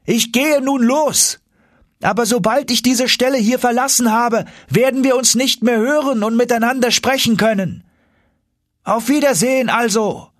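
calling through tunnels. Acted. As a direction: shout it toward a far-off voice. Axel calls back through the tunnels.

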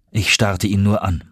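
usual speaking voice

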